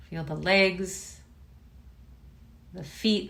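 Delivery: unhurried body-scan voice, long pauses; name each body part, then leave space; guided meditation. Real, not acted. Teacher guiding students to notice body and breath, guided meditation.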